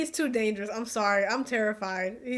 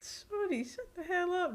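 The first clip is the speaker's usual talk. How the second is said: exasperated scolding; 9 semitones higher, 6 dB quieter than usual. Real, not acted. Scolding a noisy film character.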